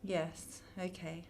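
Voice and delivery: barely audible, dazed agreement; hushed, reluctant giving in. Acted. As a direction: yield quietly to the voice. Entranced girl answering sinister voice.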